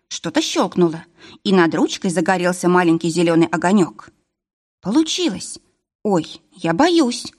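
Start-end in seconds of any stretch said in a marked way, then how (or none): 4.8-7.3 higher-pitched alarm, slowing on the fear; sudden panic. A narrator's reading. Varvara to Misha at the unlocked door, suddenly panicking. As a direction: narrator acting a woman suddenly scared. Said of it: "вдруг запаниковала Варвара".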